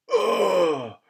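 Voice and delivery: ordinary delivery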